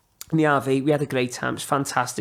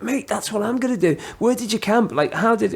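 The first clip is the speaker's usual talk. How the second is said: higher-pitched eager questions; excited; 7 semitones higher than usual. Retold or imitated. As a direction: fire off questions like an excited fan. Retelling his eager questions to walker.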